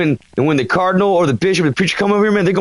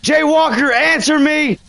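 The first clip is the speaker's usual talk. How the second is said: calling out; 5 semitones higher than usual. Real, not acted. Calling out to the ghost.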